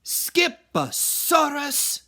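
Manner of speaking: high, loud, slow exclamation; indignant mama. Acted. Mama walking in and catching him with the bones, high and loud. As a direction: echo his word in disbelief. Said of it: "declared Mama".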